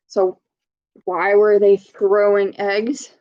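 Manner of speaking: ordinary delivery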